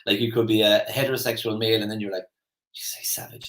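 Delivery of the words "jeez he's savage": mock awed near-whisper; hushed admiration. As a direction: marvel under your breath, barely voiced. Acts lads' awed admiration of player.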